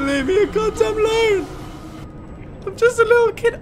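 high whine, playing the helpless kid; high-pitched pleading. Player pleads as a little kid, high-pitched.

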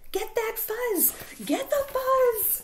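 high shout, building and stretched out; excited battle cry. Acted. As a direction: yell it like a mob giving chase. Acted mob fighting over fuzz.

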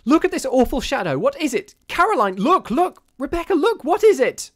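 high, loud shriek of horror. A narrator's reading. Missus Brigham to her sisters on seeing the shadow, shrieking. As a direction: shriek it out in sudden terror. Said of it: "suddenly she shrieked out".

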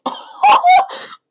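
high squealing laugh. Real, not acted. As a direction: burst out laughing at your own voice. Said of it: "Oh, la voix!".

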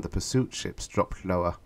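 ordinary delivery

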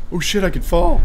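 high, loud frightened yelp; startled. Real, not acted. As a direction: blurt it out in sudden fright. Nearly falls off the map.

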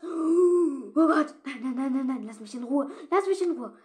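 high, loud frantic pleading; terrified screaming. Real, not acted. Player begging the attacking werewolf to leave her alone, screaming. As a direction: scream at it to go away.

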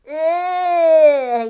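loud, high cry of disgust; disgusted ugh. Acted. Moon hare tastes the crisps and cries out in disgust. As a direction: retch at the taste. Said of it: "pulling a face".

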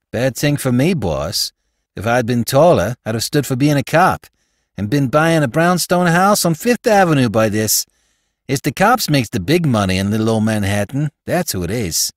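lightly voiced New York street crook; a hint of a Bowery rogue, eager to help; slangy small-time crook talking to his boss; Spike, slangy New York crook.

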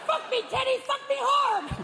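very high squawked mimicry; shrieking parrot parroting bedroom talk. Parrot exposing an affair before guests, squawking. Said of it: "and the parrot will go".

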